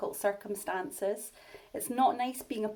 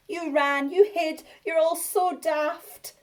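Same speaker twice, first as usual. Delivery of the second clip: teasing; 8 semitones higher, 8 dB louder, slower than usual. Acted. Bonehead teasing friends.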